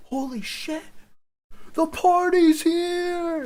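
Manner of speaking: high, loud, drawn-out party cheer; hollering happily as the party arrives; excited cheer. Retold or imitated. Friends cheering as he arrives with beer, loud and high.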